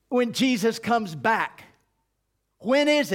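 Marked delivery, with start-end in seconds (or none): none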